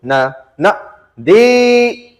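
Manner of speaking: नदी split as न, न, then a loud high दी; teacher's dictation stress. Shown as a demonstration. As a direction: dictate नदी syllable by syllable, punching दी. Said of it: "तो teacher कैसे बोलते थे?".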